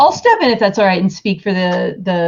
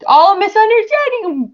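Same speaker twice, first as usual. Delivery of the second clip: much higher, pleading innocence; high-pitched innocent plea; 13 semitones higher than usual. Acted. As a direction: squeak it out like a falsely accused captive. Captive player pleads in character.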